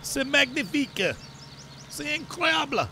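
high, theatrical French exclamations; hammed-up French delight. Farmer celebrating his first bales, hammed-up high French. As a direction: gush in mock French like a proud chef.

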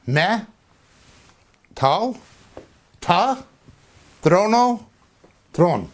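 Me, tau, ta, trono, tron said slowly piece by piece; step-by-step sound out. Shown as a demonstration. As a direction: build the word syllable by syllable with pauses. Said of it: "phonetic adjustment".

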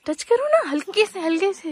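high, excited urging fading to gentle; eager urging. Real, not acted. Woman urging the child to gently touch the butterfly, high and eager.

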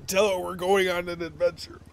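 throat still rough from dust; coughing on dust. Real, not acted. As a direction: speak through a dusty cough. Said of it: "I inhaled dust".

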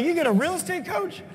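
like you just heard something unbelievable; amazed disbelief. Retold or imitated. Learns coaching exists.

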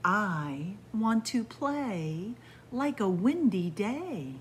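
slow, lilting storybook read; reading to children. Acted. Storyteller reading the windy-day book to kids, lilting. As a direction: savour each image for little listeners.

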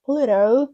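loud "Pluto" said "Pluro"; American flap. Shown as a demonstration. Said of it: "р, р".

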